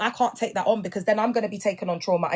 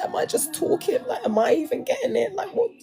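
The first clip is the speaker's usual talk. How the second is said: doubting self; 11 semitones higher than usual. Retold or imitated. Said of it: "I'm like".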